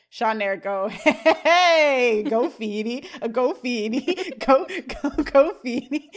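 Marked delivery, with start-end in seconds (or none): 1.4-6.1 loud, drawn-out "hey" into a party chant; hammed-up celebratory chanting; mock cheering. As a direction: cheer it on like a silly dance chant. Retold pair cheering and dancing.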